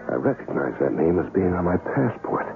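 usual speaking voice

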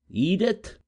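high calling cry; cried out. Acted. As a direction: call her name sharply. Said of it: "cried her rival".

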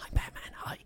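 whispered, breathy Batman line; whispery Batman. Pretend. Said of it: "a whispery Batman".

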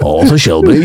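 low Brummie Arthur Shelby; a gruff Birmingham voice; Brummie accent. Retold or imitated. Repeating the Birmingham example in a low Brummie voice.

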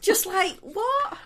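very high, loud shouted question; mock yell. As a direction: yell it back, pitch leaping up. Acting out the shouted exchange.

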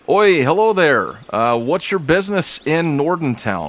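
a guard calling out loud and high, drawn out; gate guard. Acted. Guard hails the party at the gate, loud call. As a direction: hail the travellers from the wall.

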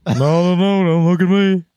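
loud, high cartoon giant wail; Simpsons giant voice. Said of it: "classic sort of Simpsons giant voice".

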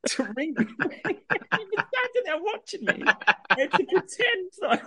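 high-pitched, laughing words; laughing through it. Real, not acted. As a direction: squeak it out while cracking up. Laughing while retelling absurd request.

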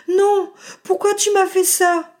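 high, slow tearful stammer; crying. Acted. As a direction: sob it out, betrayed. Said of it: "bafouilla-t-elle en pleurant".

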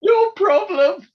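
high, slow squeak of a voice; comic falsetto no problem; squeaky mock reply. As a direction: squeak it out like a crying man pretending all is fine. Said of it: "And he went".

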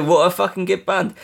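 usual speaking voice

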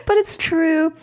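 laughing. Real, not acted. Laughing after her own remark.

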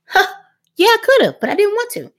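put-on high matter-of-fact kid voice; blunt kid reply. Son bluntly telling mom he chose not to, high. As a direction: toss it off plainly, high.

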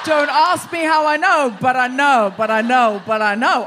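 loud, mock-certain repetition; hammed-up knowing insistence; mock insistent. Host playing a know-it-all, loud and insistent.

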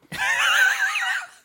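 loud high cackle; shrieking laugh. Real, not acted.

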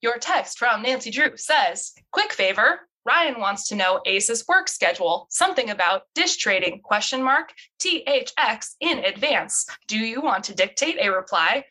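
stilted, word-by-word virtual assistant; phone AI assistant. Acted. Assistant reading Nancy's text to Bess, stilted and mechanical. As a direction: read it aloud like a text-to-speech helper.

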